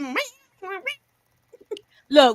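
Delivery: lightly imitated cat meow; short spaced meows, teasing; mock cat meows. Co-host joking that Kayla was going in, mock cat meows. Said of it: "She was like".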